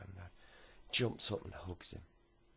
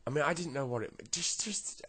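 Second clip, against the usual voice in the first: high, loud, tumbling false starts; flustered stammering; 6 semitones higher, 7 dB louder than usual. Acted. Boy tries to explain to mum, flustered stammering. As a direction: blurt excuses and keep breaking off.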